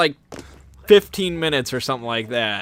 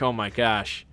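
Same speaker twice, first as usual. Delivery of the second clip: resigned mutter; 5 semitones lower, 8 dB quieter than usual. Retold or imitated. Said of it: "And I'm like".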